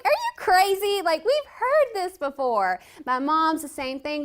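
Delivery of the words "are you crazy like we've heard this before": high, loud mock-incredulous dad; skeptical father. Father refusing his daughter's plea, loud mock disbelief. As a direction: scoff like a fed-up father. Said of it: "He's like".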